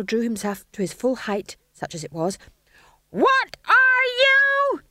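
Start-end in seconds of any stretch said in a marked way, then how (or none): 3.1-4.8 very high, drawn-out scream. A narrator's reading. Stage manager at climax of the drill, screaming. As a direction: scream the challenge slowly. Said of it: "he screamed".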